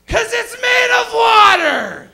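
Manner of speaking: high, slow, drawn-out holler; exasperated mock shout. Comedian schooling the crowd on warnings, exasperated mock shout. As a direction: holler it as the painfully obvious answer.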